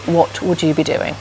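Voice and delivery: ordinary talk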